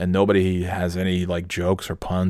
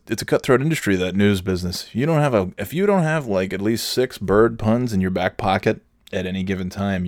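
ordinary talk, nothing marked

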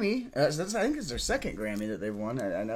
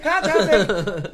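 slow, high, loud announcer voice; dramatic announcer; 5 semitones higher, 9 dB louder, much slower than usual. Shown as a demonstration. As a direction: drag it out like a TV promo. Repeats phrase plainly then dramatically.

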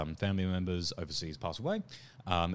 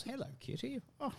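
talking to a cat; 8 dB quieter than usual. Real, not acted. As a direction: coo at the cat. Greets cat mid-sentence.

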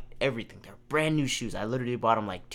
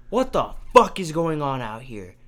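mock angry mom; 3 semitones higher, 6 dB louder than usual. Mom yelling from the door.

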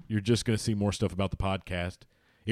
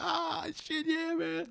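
put-on excited kid; 6 dB quieter, much slower than usual. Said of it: "I was like".

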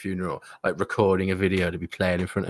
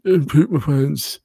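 put-on old man voice; 6 semitones higher, 6 dB louder, slower than usual. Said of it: "old, saggy-faced Joe being like".

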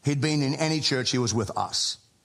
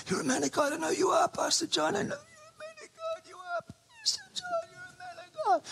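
very high mock-sobbing wail, softer; hammed-up crying; 6 dB quieter than usual. Caller on the phone pleading with Pastor John, in a hammed-up high sob. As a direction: squeak it out in a fake weepy falsetto.

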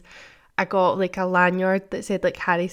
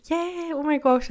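mock polite enthusiasm; 9 semitones higher than usual. Confused girl fakes enthusiasm.

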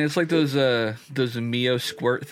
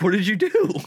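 alarmed shout; 8 semitones higher, 4 dB louder than usual. Retold or imitated. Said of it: "I'm like".

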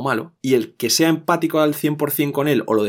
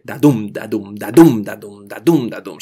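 "da-dum" repeated as a heartbeat, DUM punched louder; heartbeat rhythm; 6 dB louder, slower than usual. Shown as a demonstration. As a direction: beat out iambs, weak "da", strong "dum". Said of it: "un ritmo que suena como un corazón".

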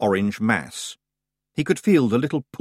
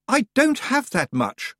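high-pitched, moderately raised cry; shouted protest; 14 semitones higher than usual. A narrator's reading. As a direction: call it out high and drawn out. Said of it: "Roland shouted".

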